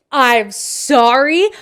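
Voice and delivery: slow, loud, incredulous retort; indignant disbelief. Retold or imitated. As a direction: drag it out, offended and incredulous. Indignant reaction to husband's remark.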